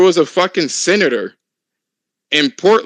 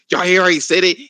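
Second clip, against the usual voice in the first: a touch of indignant online accuser; lightly put-on scandalized voice; mock outraged poster; 2 semitones higher than usual. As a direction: call out the crowd, faking shock. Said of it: "they'll clip it and retweet it".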